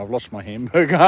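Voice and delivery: ordinary talk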